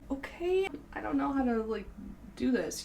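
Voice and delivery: high, lost and overwhelmed; mock bewilderment. Her past self reacting to the marketing emails, mock bewildered. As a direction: play your clueless past self. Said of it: "And it's like".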